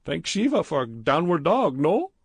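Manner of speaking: put-on high, boastful heavy Indian accent; cocky Indian accent. A narrator's reading. Badrul bragging to Stephen, cocky Indian accent. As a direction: show off, pleased with yourself. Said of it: "cocky without cause".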